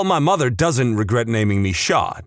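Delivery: normal talking voice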